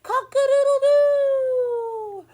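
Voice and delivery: long, high, loud crowing cock-a-doodle-doo; rooster crow. Pretend. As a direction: stretch it out like a proud rooster. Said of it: "rooster crows in the story".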